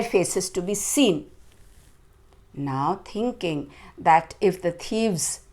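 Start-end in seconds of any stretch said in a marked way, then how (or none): none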